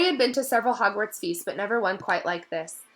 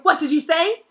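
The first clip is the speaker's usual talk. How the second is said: higher, louder sharp retort; spat; 9 semitones higher, 9 dB louder than usual. A narrator's reading. Malfoy spits a question at the elf. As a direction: spit the question out.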